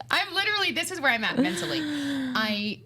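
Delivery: high-pitched mock despair, easing down; spiralling into comic helplessness; retold panic. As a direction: wail it like a hammed-up meltdown. Retelling her spiralling panic.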